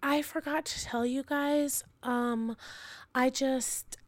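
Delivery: drawn-out, high-pitched hemming and hawing; hesitant child. Retold or imitated. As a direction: imitate a child stalling over a confession. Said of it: "Piper comes in, and she goes".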